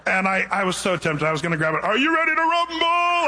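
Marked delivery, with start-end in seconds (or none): 1.8-3.2 boxing announcer. Pretend. Pastor imagines hijacking the lowered mic, announcer boom. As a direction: belt it out like a fight announcer.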